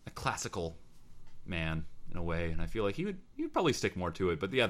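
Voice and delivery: ordinary delivery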